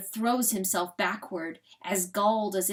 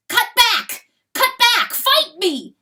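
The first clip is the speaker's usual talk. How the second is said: high, loud shouted commands; furious goading; 13 semitones higher, 12 dB louder than usual. Acted. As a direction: scream at him to fight back. Rooster goads coyote to fight.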